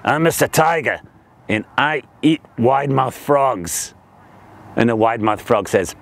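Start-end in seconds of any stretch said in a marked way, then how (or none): 0.0-3.9 low, deliberate tiger voice; big hungry tiger. Acted. Tiger tells the frog what he eats, low menace.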